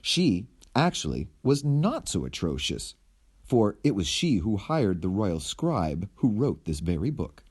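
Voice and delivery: usual speaking voice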